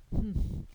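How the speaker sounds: a breathy disapproving humph; sniff. A narrator's reading. Marilla scoffs at Matthew's gift, a sniffing humph. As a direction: snort it out through the nose in disdain. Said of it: "sniffed Marilla".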